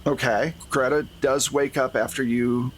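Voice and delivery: normal talking voice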